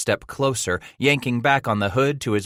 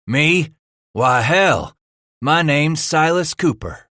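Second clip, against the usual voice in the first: boastful frontiersman; 8 semitones higher, 6 dB louder, slower than usual. Acted. Leader boasts name.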